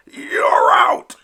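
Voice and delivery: very high, loud call; umpire's cry. Acted. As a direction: fling the out call. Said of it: "cried the umpire".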